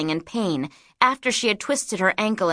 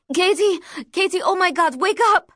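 frantic shout; 10 semitones higher, faster than usual. Acted. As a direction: shout her awake in a panic. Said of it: "her mother shouted into her ear".

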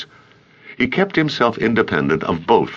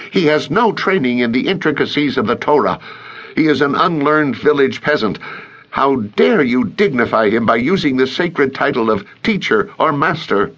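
higher, contemptuous council elder; scornful Pharisee; 7 semitones higher than usual. A narrator's reading. Azariah scolding a colleague before the council, high and scornful. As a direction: sneer down at the peasant rabbi.

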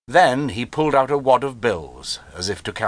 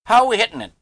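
high, louder, chirpy question; cheerful cry; 13 semitones higher, 5 dB louder, much faster than usual. A narrator's reading. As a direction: ask it like an eager boy. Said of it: "cried Harvey cheerfully".

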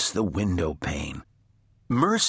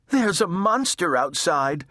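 timid little sister; 8 semitones higher than usual. A narrator's reading. Baby reports a monster, high voice.

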